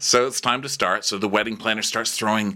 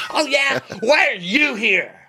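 much higher, indignant mock whine; mock Austin; 3 dB louder than usual. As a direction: fire back in a high offended squeal. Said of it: "And he goes".

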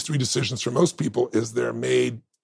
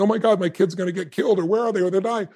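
worried parent; 7 semitones higher than usual. Retold or imitated. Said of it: "So if you're thinking".

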